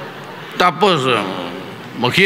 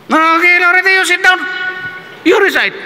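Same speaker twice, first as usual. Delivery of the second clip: imitated professor. Professor ordering a student to recite, high mock command. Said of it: "Sabi niya".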